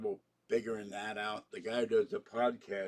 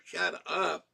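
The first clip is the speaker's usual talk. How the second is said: sharp, louder shut up; fed-up outburst; 7 semitones higher, 4 dB louder than usual. Retold or imitated. Romulan captain silencing a moralizing Picard, fed up and louder. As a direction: cut the lecture off. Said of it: "And I go".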